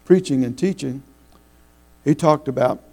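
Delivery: ordinary talk, nothing marked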